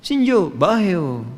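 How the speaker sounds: slow, drawn-out Libyan Arabic greeting; Libyan dialect. Retold or imitated. Teacher showing students how Libyans greet. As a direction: say it the Libyan way, slowly. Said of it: "Orang Libya bilangnya".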